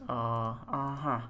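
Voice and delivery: ordinary talk, nothing marked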